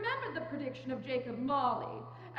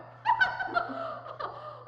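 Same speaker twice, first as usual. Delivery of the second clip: high cackling laugh; gleeful cackle; 12 semitones higher, 4 dB louder than usual. Acted. Charwoman laughing at his lonely funeral.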